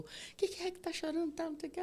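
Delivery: high, hurried voice of the brother; worried brother. Retold or imitated. Brother arriving at the hospital asking why she cries, high and hurried. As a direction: rush in asking what's wrong. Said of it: "meu irmão chegou".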